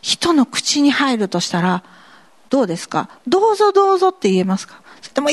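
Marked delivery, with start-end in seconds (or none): 3.3-4.1 much higher, louder, cheery offer; eager offer. Pretend. A believer inviting others to drink, high and cheery. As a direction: sing out a welcoming go-ahead. Acting willing believer's reply.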